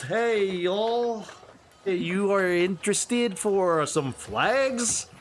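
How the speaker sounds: high, forced American drawl greeting; weird American accent. Acted. Shopkeeper greets the player in a forced American accent. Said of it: "it's very enforced".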